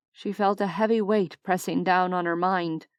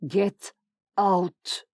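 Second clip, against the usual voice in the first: snarled. Acted.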